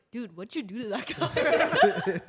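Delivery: loud, slow, amused mock question; laughing retelling. Friend asking her about the guy, laughingly imitated. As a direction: quote the friend, breaking into laughter.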